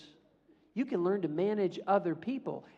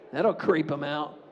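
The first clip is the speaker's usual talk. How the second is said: normal talking voice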